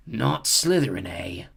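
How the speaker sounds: slow, sly hat voice; sly hat echoing "Not Slytherin"; small hat voice. A narrator's reading. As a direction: tease him slowly as the hat. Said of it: "said the small voice".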